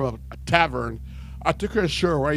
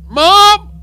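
high, loud reenacted call; 14 dB louder than usual. Son in church calls his mom, shouting. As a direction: holler for a parent.

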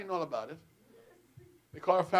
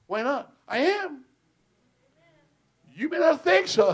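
rising, louder boast to his wife; playful boast; 10 semitones higher, 8 dB louder than usual. Retold or imitated. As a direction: crow it like a proud king.

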